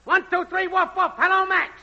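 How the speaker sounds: high-pitched mic-check holler; woofing and yelling. Acted. Technician testing the network for Max, woofing and yelling. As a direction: yell out a test call to Max. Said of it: "woofing and yelling, "Hello, Max".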